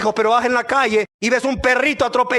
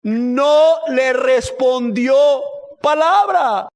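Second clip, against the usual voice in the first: each of "No le respondió palabra" stretched slowly and pushed louder; slow, forceful NO, then a high PALABRA; dramatic emphasis; 3 semitones higher, 4 dB louder, much slower than usual. Real, not acted. Preacher dramatizes Jesus's silence.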